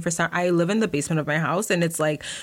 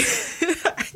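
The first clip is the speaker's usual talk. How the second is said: breathy giggle; 11 semitones higher than usual. Real, not acted. Laugh after put-on line.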